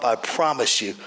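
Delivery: usual speaking voice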